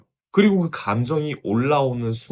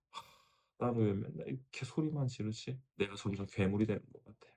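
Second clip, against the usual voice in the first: much softer, deflated self-reproach; heartbroken sigh; 10 dB quieter than usual. Retold or imitated. Mother alone after yelling, blaming herself, soft and defeated. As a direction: let it collapse into a quiet, defeated sigh. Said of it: "엄마 마음이 무너집니다".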